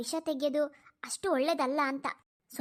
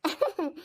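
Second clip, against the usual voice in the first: amused chuckle. Acted. Mother amused by child's school reminder, chuckling.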